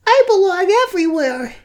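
squeaky high voice for the dog. Pretend. Owner voicing her dog, very high and squeaky. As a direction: speak as the cheeky dog, very high.

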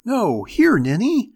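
put-on high, loud, exasperated little girl; impatient kid giving orders; bossy child. A narrator's reading. Bossy child corrects playmates.

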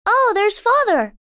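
put-on high, glad child's cry; a girl hearing her father come home; excited exclamation. A narrator's reading. Amy hears her father below and exclaims happily.

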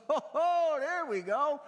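high, loud excited yelp of a country man; hammed-up good old boy cheering; imitating the good old boy. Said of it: "He goes".

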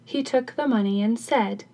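plain reading voice

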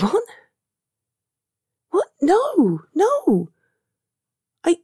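high-pitched, disbelieving no; shocked denial. Acted. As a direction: deny it at once, pitch leaping up. Friend is shocked by apology.

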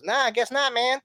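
very high-pitched little voice; squeaky-high rapper impersonation. Retold or imitated. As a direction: pipe up in a high impression. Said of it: "have that, like, high voice".

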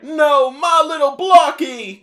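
mock-grieving wail, high, loud and drawn out; King Dedede. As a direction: wail like a cartoon king who lost his son. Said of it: "mourning Blocky like he just lost his firstborn son".

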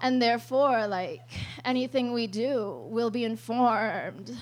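slow, soft and airy, drawn out; dreamy mock mystic. Girlfriend explaining stardust to her partner, slow dreamy mock mysticism. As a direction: float the words like a spaced-out stargazer.

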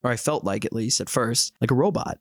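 ordinary talk, nothing marked

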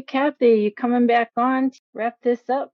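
normal talking voice

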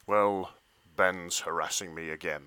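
put-on low, deep, moody teen voice; [name]'s voice. [name] reads his lines, low and brooding. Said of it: "over to you".

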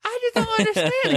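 put-on very high, wounded old woman's cry; old lady voice. Retelling the movie, a high wounded old woman. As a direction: squeak it out like a hurt old lady.

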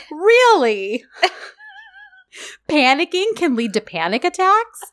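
high, laughing incredulity; laughing disbelief. Real, not acted. Host laughing at the drama doctor's advice, high and amused. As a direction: laugh it out, can't believe the advice.

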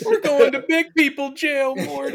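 high, loud rasping mock Rick. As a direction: bark it like a drunk mad scientist. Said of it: "talking like Morty".

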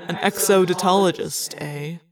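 faintly scornful, dropping on the tag question; slight sneer. A narrator's reading. Alaran mocks Arbron's scholarly interest, sneering slightly. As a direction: sneer lightly at the title. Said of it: "Alaran said with a slight sneer".

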